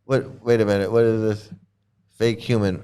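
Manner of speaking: usual speaking voice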